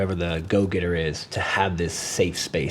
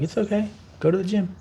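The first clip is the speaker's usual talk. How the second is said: gentle wife voice; 11 semitones higher, faster than usual. Retold or imitated. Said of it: "my wife is like".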